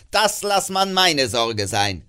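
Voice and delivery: put-on slow, loud, sneering old man; sarcastic miser. Scrooge mocks his nephew's Christmas, slow and sneering. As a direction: drag out the sarcasm.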